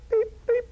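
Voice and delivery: high, clipped electronic beeps; tiny high beeps from a TV screen; imitated beeping. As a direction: chirp it like a warning light. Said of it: "beep, like that".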